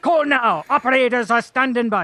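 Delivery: mock advert in a hammed-up Scottish accent. Shown as a demonstration. Host spoofing a phone-in advert, Scottish accent. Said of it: "do it with a Scottish accent".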